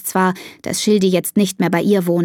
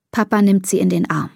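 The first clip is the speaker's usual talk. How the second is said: plain reading voice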